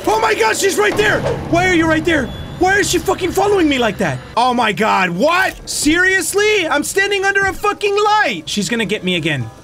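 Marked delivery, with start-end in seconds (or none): 0.0-4.2 high, frantic pleading outburst; panicked shouting. Real, not acted. Player finding the ghost following them, panicked shouting. 5.1-8.4 high-pitched incredulous protest; outraged panic. Real, not acted. Player caught despite standing under a light, outraged panic.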